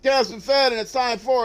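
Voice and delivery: high, bright, loud hello to viewers; excited greeting. Acted. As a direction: hype up the stream opening. Stream opening greeting.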